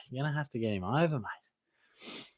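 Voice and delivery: soft throwaway echo. Shown as a demonstration. Streamer repeats his reply to a viewer, softly, under his breath. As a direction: toss it off again under your breath. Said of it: "Oh, you're gonna have to get him over, mate".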